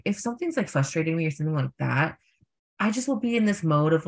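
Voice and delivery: ordinary delivery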